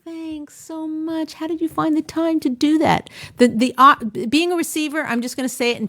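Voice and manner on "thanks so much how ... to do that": very slow, higher, sweetly grateful; sweet thanks. Retold or imitated. Wife thanking husband for changing the bulb, sweetly. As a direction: savour each word of thanks. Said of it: "You think".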